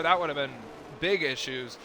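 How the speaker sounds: usual speaking voice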